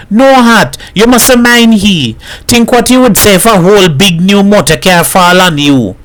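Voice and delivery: high-pitched coaxing protest; pleading wife. A narrator's reading. Wife asks Hat to stay and help, pleading.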